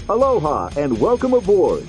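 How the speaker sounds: ordinary talk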